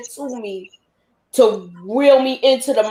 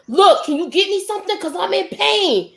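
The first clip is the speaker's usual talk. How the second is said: desperate plea, higher; 8 semitones higher than usual. Retold or imitated. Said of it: "the third time".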